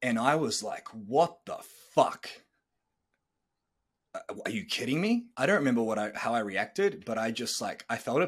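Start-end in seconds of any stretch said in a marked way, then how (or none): 0.9-5.3 reliving a stunned, incredulous reaction; stunned disbelief. Retold or imitated. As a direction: retell the shock, dragging out the first words. Retells stunned reaction to breakup.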